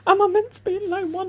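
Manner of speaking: high, loud panicky self-quote; imitating her panicked phone call. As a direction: squeal it lost on the motorway. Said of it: "And I said".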